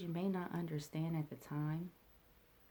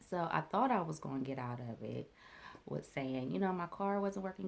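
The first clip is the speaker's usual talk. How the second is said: normal talking voice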